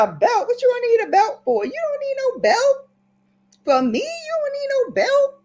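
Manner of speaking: put-on very high-pitched indignant kid; hammed-up child's back-talk; mock outraged protest. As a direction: squeal in disbelief, rising at the ends. Child disputing the threatened belt.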